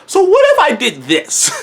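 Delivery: high, hesitant so-what with a laugh; coy high half-laughed excuse; mock sheepish excuse. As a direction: pitch up like you're testing the waters.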